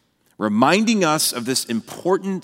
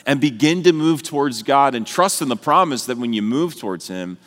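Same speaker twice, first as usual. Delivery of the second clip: usual speaking voice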